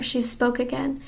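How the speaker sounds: plain reading voice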